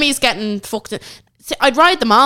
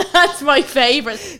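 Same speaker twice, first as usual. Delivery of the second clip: ordinary talk, nothing marked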